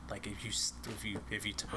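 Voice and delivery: usual speaking voice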